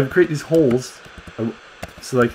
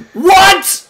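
high, loud astonished cry; amazed; 6 dB louder than usual. Real, not acted. Parent watching the baby dragon wake, amazed. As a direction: burst out in delighted disbelief.

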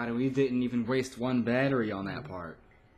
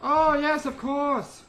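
high shouted dismay; panicked yell; 14 semitones higher, 8 dB louder, slower than usual. Real, not acted. Panicked sarcasm while fleeing.

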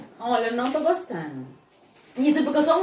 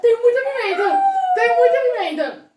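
reclamando alto; 10 semitones higher, 5 dB louder than usual. Real, not acted. Boca ardendo de pimenta.

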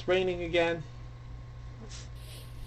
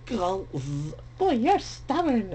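witch voice; 4 semitones higher, slower than usual. Acted. Voicing the witch.

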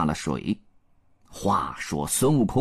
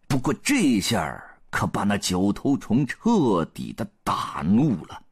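ordinary delivery, nothing marked

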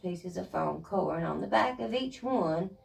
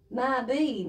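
plain reading voice

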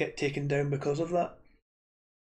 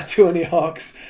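excited; 2 semitones higher, 11 dB louder, slower than usual. Real, not acted. Excited game idea with laugh.

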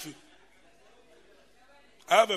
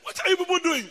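laughing; 10 semitones higher, 5 dB louder than usual. Retold or imitated. Protesting while laughing.

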